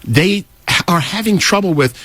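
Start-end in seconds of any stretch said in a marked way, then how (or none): none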